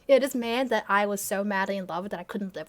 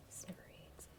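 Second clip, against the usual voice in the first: breathy whisper of one word; 25 dB quieter than usual. Real, not acted. Savouring her own old word choice, whispering. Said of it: "whispered repeat of word".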